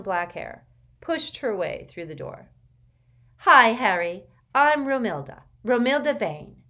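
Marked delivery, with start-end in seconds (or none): 3.4-6.6 loud, sure of herself; loud and confident. A narrator's reading. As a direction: announce yourself boldly. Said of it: "she said loudly and confidently".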